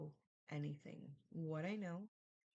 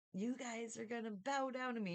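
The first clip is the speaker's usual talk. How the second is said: innocent Joseph; 5 semitones higher than usual. Retold or imitated. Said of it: "he didn't say it in a cocky way".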